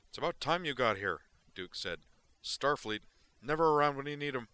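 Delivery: big, wired, careless delivery; Duke's voice. Acted. Duke brushes off Zoner's question, loud and wired.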